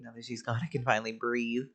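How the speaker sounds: ordinary talk, nothing marked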